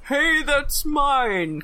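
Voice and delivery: slow, dopey whine of outrage; Sindar's dumb guy voice. Retold or imitated. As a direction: drag it out like a clueless oaf. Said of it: "Peter Cullen doing a dumb guy voice".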